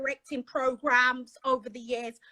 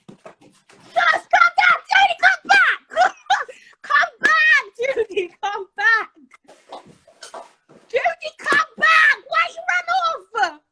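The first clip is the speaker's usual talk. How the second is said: loud high shout, laughing; calling out; 11 semitones higher, 7 dB louder, slower than usual. Real, not acted. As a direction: call after her. Calling after someone leaving.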